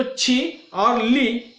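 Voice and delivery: छी and ली slow and drawn out; stressed syllables. Shown as a demonstration. Teacher picking out two stressed sounds, drawn-out stress. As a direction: lean long on छी, then on ली. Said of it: "इन दो ध्वनियों पर ही हम ज्यादातर बल".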